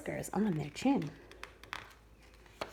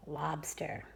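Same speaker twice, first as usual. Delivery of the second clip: soft whispered title; 4 semitones lower, 10 dB quieter than usual. Acted. Reader whispering the lobster heading to a child. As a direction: breathe the word out.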